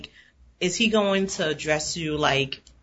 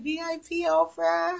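high, drawn-out squeal without volume; mock request; 13 semitones higher, 4 dB quieter than usual. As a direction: pitch it way up and stretch it out softly. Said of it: "Be like".

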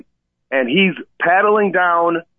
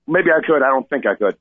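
ordinary delivery

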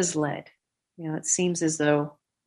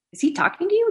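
amazed; 12 semitones higher, faster than usual. Retold or imitated. Brother amazed.

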